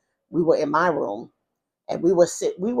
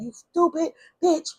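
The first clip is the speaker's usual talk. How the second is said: raised-pitch retelling of an angry yell; retold yelling; 11 semitones higher than usual. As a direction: imitate his yelling without raising the volume. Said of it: "he was yelling".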